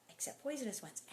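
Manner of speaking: whispered aside. Real, not acted. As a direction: whisper the exception.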